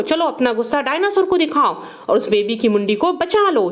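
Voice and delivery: usual speaking voice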